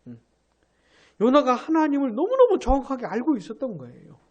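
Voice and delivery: normal talking voice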